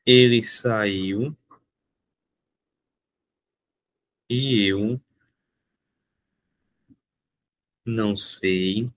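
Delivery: very slow, word by word, long pauses; spaced-out dictation. Shown as a demonstration. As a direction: dictate it slowly for the class. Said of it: "dictating example slowly".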